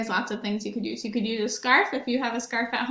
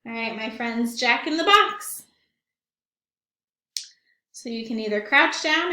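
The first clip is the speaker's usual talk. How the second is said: ordinary talk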